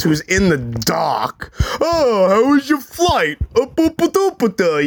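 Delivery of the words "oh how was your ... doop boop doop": mock him as an oblivious fool; mocking dumb stepfather. Stepfather asking about the fake flight, mocked as dim. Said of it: "you're just so dumb".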